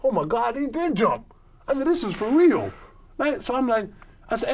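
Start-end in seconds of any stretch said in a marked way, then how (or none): 0.0-2.7 high, stunned realization; shocked disbelief. Retold or imitated. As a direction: gasp it out in disbelief. Retold shock at jump.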